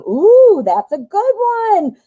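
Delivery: delighted high ooh; thrilled at a good card; excited. Real, not acted.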